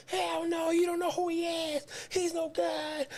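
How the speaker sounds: mock mother refusing flatly; imitating his mom. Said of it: "She was like".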